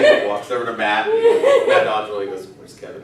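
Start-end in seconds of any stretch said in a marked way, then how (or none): 2.6-3.0 hushed whisper, barely voiced; whispered question. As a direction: whisper it so the congregation can't hear. Hushed question during church service.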